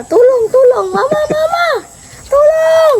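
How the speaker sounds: put-on high, loud child's cry of tulong mama; child crying for help. Child imitating a sibling's panicked cry for mama. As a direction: mimic the little kid panicking on the ride. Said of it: "sige siyang ganyan".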